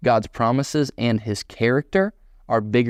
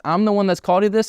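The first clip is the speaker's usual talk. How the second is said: emphatic build; 9 semitones higher, much faster than usual. Acted. Preacher voicing God insisting to Moses, higher and faster. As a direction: drive the repeated line up to a peak.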